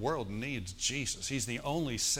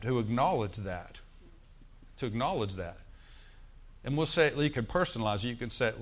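ordinary talk, nothing marked

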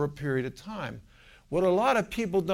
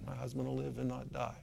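timid murmur; 4 semitones lower, 14 dB quieter than usual. Retold or imitated. Wife first starts saying it.

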